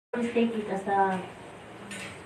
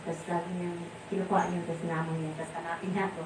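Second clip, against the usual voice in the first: ordinary delivery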